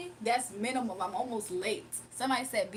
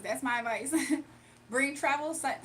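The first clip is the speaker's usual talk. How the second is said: ordinary delivery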